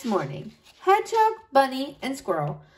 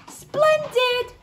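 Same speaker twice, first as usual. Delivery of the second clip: chirpy high reply; 13 semitones higher than usual. Acted. Bunny's cheerful reply.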